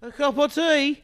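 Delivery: put-on high, jokey English accent; mock British. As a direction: tease like a stiff-upper-lip Brit. Cohost jokes in British voice.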